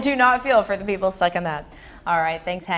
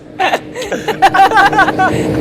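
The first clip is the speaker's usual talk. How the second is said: loud laughter; 7 semitones higher, 8 dB louder than usual. Real, not acted. Anchor laughing hard.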